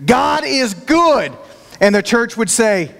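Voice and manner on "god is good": slow preacher call, a bit higher; pastor's call. Retold or imitated. Pastor calls out to the congregation, slow and lifted. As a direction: draw out the call like a Pentecostal pastor. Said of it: "the pastor would get up there and say".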